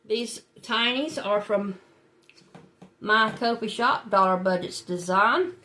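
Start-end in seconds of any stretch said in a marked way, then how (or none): none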